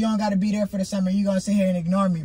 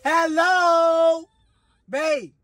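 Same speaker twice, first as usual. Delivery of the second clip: calling out; 8 semitones higher, much slower than usual. Real, not acted. Being ignored, calling out louder.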